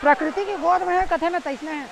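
calling out in delight over the water; excited. Real, not acted. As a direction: awestruck and pitched high at the waterfall. Thrilled on reaching the waterfall.

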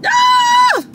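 very high, loud panicked shriek. Real, not acted. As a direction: scream in embarrassment. Panics after sending text.

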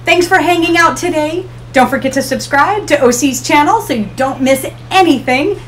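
ordinary talk, nothing marked

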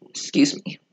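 ordinary talk, nothing marked

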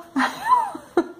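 high, quick burst of real laughter. Real, not acted. Mother laughing at her son's excuse, high burst of laughter.

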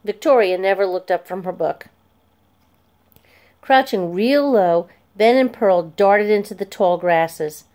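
usual speaking voice

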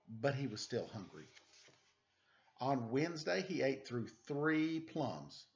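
slow, gentle picture-book read-aloud; reading to children. Acted. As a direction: read it like a bedtime story for little kids.

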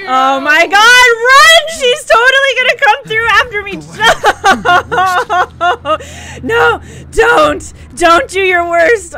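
loud, high frantic shouts; panicked yelling. Real, not acted. Streamer yells in panic as the villain chases her. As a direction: yell to flee in terror.

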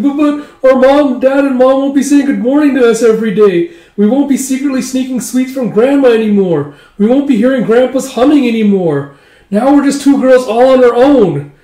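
high, loud imitation of weeping girls; tearful wailing. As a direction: wail it like a homesick young bride. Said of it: "shivering and shuddering, just tearing up".

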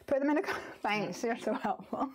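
usual speaking voice

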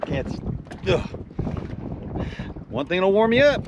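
ordinary talk

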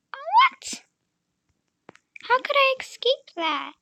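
shrill-free high, loud "What?"; high outraged disbelief. Real, not acted. Outraged at dying.

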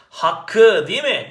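teacher drags out Hakkı as hak-kı; doubled k shown. Shown as a demonstration. Teacher showing students the doubled consonant, slow and loud. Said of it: "Hakkı demiyor da hakkı diyor".

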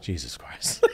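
quiet, low "Jesus Christ"; low muttered disbelief. Retold or imitated. Retelling Gordon Ramsay's shock at the chef, low disbelief. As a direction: drop it low, half-laughing.

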